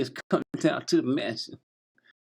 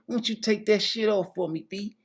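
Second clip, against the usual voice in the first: higher, egging-on voice; 7 semitones higher than usual. Acted. Host egging the dancer on in a raised voice. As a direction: cheer her on up high. [name] cheering, high voice.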